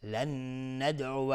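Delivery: ordinary delivery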